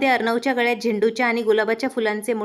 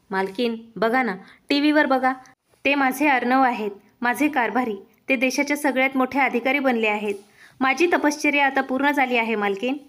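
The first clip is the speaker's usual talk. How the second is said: plain reading voice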